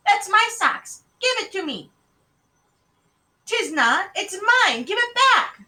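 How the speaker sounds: high, loud sibling quarrel; shrill-pitched childish back-and-forth; squabbling children. A narrator's reading. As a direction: bicker like two little sisters. Twin sisters quarrel over socks.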